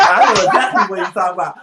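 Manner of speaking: laughing through the words. Real, not acted. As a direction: say it while cracking up. Teasing banter, laughing aloud.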